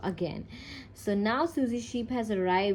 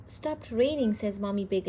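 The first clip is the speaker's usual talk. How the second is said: ordinary delivery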